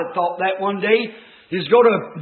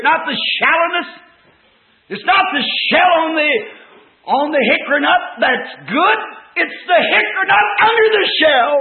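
raised, high pulpit voice; impassioned preaching; 6 semitones higher, 5 dB louder than usual. Real, not acted. Preacher contrasting shell and hickory nut, impassioned full-force preaching. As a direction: drive the point home at full force.

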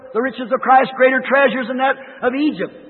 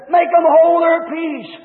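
lightly voiced complaining priests, higher and slow; a hint of scolding Pharisees; drawn-out mock demand for silence; indignant priests; 5 semitones higher, 4 dB louder, slower than usual. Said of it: "the old priests and Pharisees and all said".

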